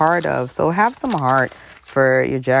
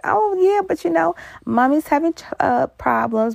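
put-on much higher, hesitant girlish voice; mock Christina; 14 semitones higher than usual. Christina explaining to uncle, high hesitant. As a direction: cover for mommy awkwardly. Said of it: "she was like".

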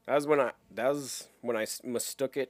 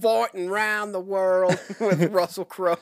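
mock announcer; 7 semitones higher, 5 dB louder than usual. Mock announcer tagline, laughing.

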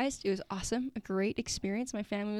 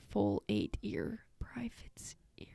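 whisper; 11 semitones lower, 7 dB quieter, slower than usual. Real, not acted. Sounding out the clue.

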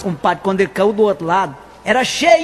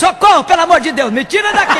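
mock cry for help; 7 semitones higher, 4 dB louder than usual. Old woman fallen in grave.

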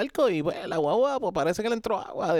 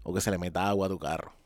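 serious disclaimer; 9 semitones lower, 7 dB quieter than usual. Real, not acted. Podcaster warning listeners about flood coverage, serious disclaimer. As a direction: quietly spell it out, low and measured. Clarifying insurance rule to listeners.

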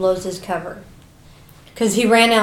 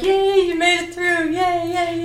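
higher sing-song yay; mock cheering crowd; 9 semitones higher than usual. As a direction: chant it like a silly crowd. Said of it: "the whole school's cheering, like".

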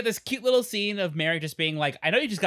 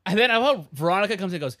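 usual speaking voice

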